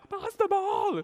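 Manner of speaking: very high, strained yell at ordinary volume; high-pitched pretend shout; mock scream. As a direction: imitate a teammate screaming from afar. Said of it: "he was screaming at the top of his lungs".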